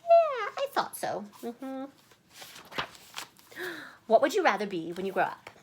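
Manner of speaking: plain reading voice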